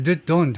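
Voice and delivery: "the don't" with a schwa, like "thuh don't"; weak THAT before don't. Shown as a demonstration. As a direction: make the first word of "the don't" almost vanish. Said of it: "하면 안 된다". ("the don't"를 '드 돈'처럼 약하게)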